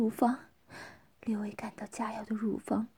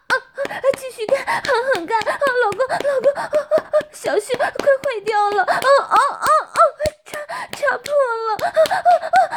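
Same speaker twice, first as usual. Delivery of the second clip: very high, loud moaning wife; crying out in pleasure; 13 semitones higher, 12 dB louder, faster than usual. Acted. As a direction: build to loud, urgent, breathless cries. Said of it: "叫起来". (高声浪叫，急促喘息)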